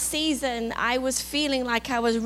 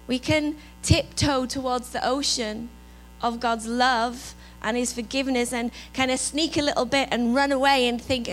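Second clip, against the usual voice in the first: ordinary talk, nothing marked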